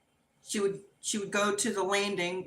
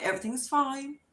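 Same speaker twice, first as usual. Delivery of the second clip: high, mock-tearful reassurance breaking up after; crying; 8 semitones higher than usual. Crying wife reassures worried guests at the door, mock tearful. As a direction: act out insisting through tears, pitched up. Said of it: "I'm crying".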